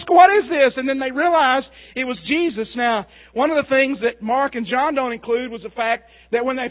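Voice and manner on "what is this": loud, high frightened cry; hollering. Retold or imitated. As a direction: holler it in panic on the boat. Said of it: "they go to hollering and carrying on".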